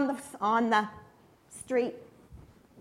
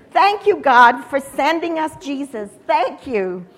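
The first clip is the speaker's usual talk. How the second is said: exultant thanks; 9 dB louder than usual. Acted. Crowd thanking God for sending Jesus, exultant. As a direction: call gratitude up over the crowd.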